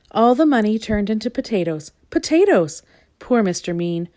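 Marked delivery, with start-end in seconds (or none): none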